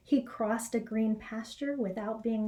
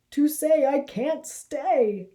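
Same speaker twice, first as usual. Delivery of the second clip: slow, louder frightened rabbit; a startled rabbit excusing himself; nervous Harold; 4 semitones higher, 7 dB louder, slower than usual. Acted. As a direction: back away politely but scared. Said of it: "said Harold".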